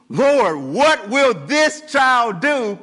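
high, loud and slow, full of awe; drawn out in wide-eyed wonder; amazed wondering. Retold or imitated. Neighbours marvel at the newborn John, awed and slow. Townspeople wonder about the child.

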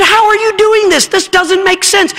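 high, incredulous gamblers' question; baffled gamblers. Retold or imitated. Gamblers demand how the dog trick works, high and baffled. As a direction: play the stumped bettors asking.

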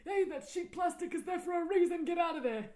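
high, loud indignant scolding; mock commentator. Matching the friend's riff, high and loud. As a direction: chase them off like a fussy host.

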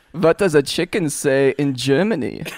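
put-on German-accented quiz question; playful German quizmaster; mock German accent. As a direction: set up the joke in a comic German voice. Comic German animal quiz.

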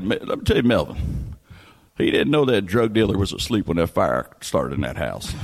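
lightly voiced low smoker uncle; Uncle Smoke. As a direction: drop the pitch a little, like a grave older man. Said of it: "smoke talk like this".

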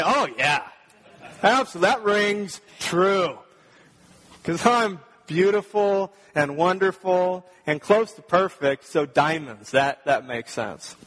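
loud, high mock self-flattery; mock smug reply. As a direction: play the smug believer for laughs. Mocking a self-satisfied listener.

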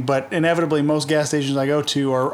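normal talking voice

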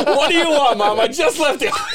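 high, loud hammed-up exasperation with amusement; mock irritated reply. Son retelling his snap at mom on the phone, amused mock irritation. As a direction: replay the outburst loudly, close to laughing.

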